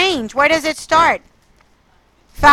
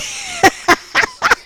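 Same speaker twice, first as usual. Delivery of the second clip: very high-pitched laughing; laughter. Real, not acted. Teacher apologizes to students for her mistake, laughing.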